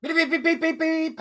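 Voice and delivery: high, sung-out beeping noises; mock beeps. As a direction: beep like a cartoon alarm. Mock censor beeps opening.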